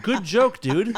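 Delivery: loud, high sarcastic quip while laughing; mock the joke with a laugh. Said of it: "I'm like".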